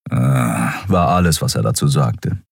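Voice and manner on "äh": usual speaking voice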